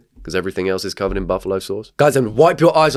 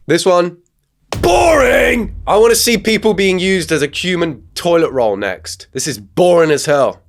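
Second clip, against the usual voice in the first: much higher and louder, booing; bored shout; 9 semitones higher, 5 dB louder than usual. Real, not acted. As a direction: yell boring at the screen. Loudly bored at video.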